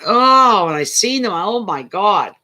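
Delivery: loud, high cry of mock horror; retold shock. Telling friends about seeing a bucket of live eels, retold shock. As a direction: re-enact a startled reaction, voice jumping up.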